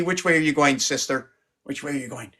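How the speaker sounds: ordinary talk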